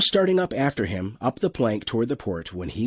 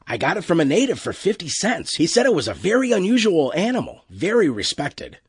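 higher-pitched, lightly acted crewman; lightly voiced crewman, eager to explain; 7 semitones higher, 3 dB louder than usual. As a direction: explain the purchase to the captain, a touch higher.

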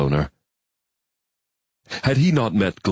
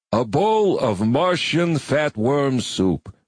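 slow, higher soothing tone; 7 semitones higher, much slower than usual. A narrator's reading. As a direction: lull him with comfort food. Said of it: "the Padre began soothingly".

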